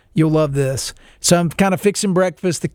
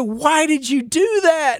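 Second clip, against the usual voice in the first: much higher and slow, mock despair; dismayed high voice; 10 semitones higher, slower than usual. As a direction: plead up high at the little son. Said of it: "I go".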